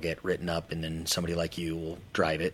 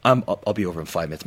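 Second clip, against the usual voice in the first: put-on rushed excited kid; 8 dB louder, faster than usual. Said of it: "calling your friend in elementary school".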